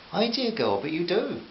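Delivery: put-on a light touch of boyish Eton excitement; posh Etonian greeting, mildly voiced; Eton accent. A narrator's reading. Sir Richard greets Carol excitedly in a light Eton accent. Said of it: "Sir Richard's Eton accent".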